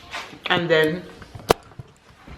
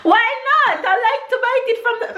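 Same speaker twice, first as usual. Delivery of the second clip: laughing; 13 semitones higher, faster than usual. Real, not acted. Eating, laughing and talking.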